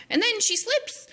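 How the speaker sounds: ordinary talk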